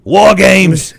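loud, high English announcer bellow of "War games"; mock English announcer yelling "War games"; announcer shout. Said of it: "all he said was one word".

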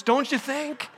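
ordinary delivery

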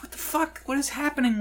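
high-pitched disbelieving yelp; what on earth is going on, squeaky; shocked. Pretend. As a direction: jump way up in pitch, baffled. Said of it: "I'm like".

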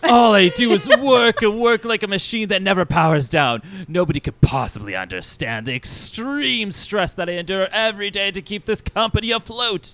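loud, high, melodramatic lament; self-pitying diva boss. Acted. Vain boss lamenting his stress, melodramatic complaint. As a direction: play the overworked martyr. Plays vain Mister Sinister.

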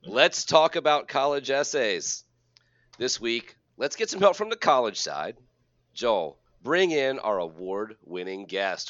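big host voice, lifted on key words; show-opening announcer. Acted. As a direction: hype up the episode like a host. Hosts the show's opening.

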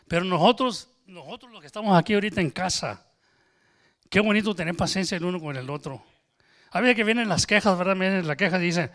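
loud, driving sermon voice; preaching at full force. Acted. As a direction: preach it out to the whole room. Preaching to congregation.

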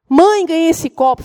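imitating a proud little girl; excited child. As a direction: call out to mom with big news. Daughter proudly tells of cup.

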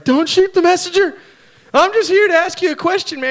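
high and loud, nervous messenger; frightened soldier begging the prophet. Retold or imitated. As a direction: blurt it out like a scared envoy pleading for his life.

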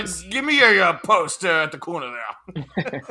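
hesitant, put-on British English; mock British accent. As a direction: fumble through a fake British play call. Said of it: "talking in a slight British accent".

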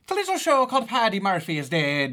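hammed-up Irish promo voice; Irish accent.

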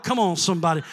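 ordinary talk, nothing marked

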